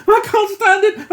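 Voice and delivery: high loud hysterical cry; first burst of panic; frantic wail; panicked cry. A narrator's reading. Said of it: "He ranted".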